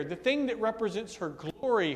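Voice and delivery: ordinary delivery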